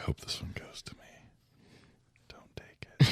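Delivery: hushed, unvoiced pleading; playful whispered plea under the breath; stage whisper. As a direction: whisper it like a secret wish. Hoping aloud during another's turn.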